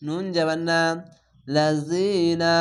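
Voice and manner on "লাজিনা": লাজিনা with the long vowel held one alif; drawn-out recitation. Shown as a demonstration. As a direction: stretch the ইয়া in লাজিনা slowly. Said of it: "এক আলিফ টেনে পড়বেন". (লাজিনা এক আলিফ টেনে ধীরে পড়া)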